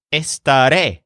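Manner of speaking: Estaré with the stress on the final re; stress on last syllable. Shown as a demonstration. Teacher models Estaré for learners, stress on the end. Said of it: "This word is pronounced with a stress on the last syllable".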